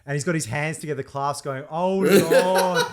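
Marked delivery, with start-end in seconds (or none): 1.7-2.8 louder, higher mock prayer; hammed-up "oh Lord" plea; priest praying in temptation. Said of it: "like, a priest or a brother there".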